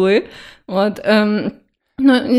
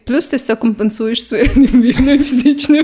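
laughing. Real, not acted. Teasing her co-host about his looks, laughing. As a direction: giggle out the compliment.

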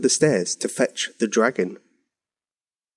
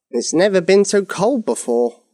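high-pitched wizard's moan; groaning; 6 semitones higher than usual. A narrator's reading. Wizard explains the hibernating dragon, groaning mildly. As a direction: complain with a mild groan, pitch raised. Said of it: "the wizard groaned".

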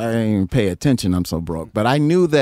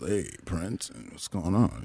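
hushed greeting; 5 semitones lower, 10 dB quieter, slower than usual. Retold or imitated. Everybody greeting Prince.

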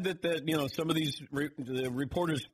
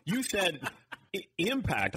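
laughing; 7 semitones higher than usual. Real, not acted. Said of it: "You have the accent on the wrong syllable".

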